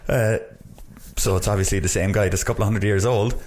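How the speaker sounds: usual speaking voice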